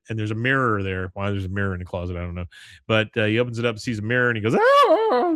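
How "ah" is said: very high, loud shriek; girlish scream. Retold or imitated. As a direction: scream like a little girl. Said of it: "Screams like a girl".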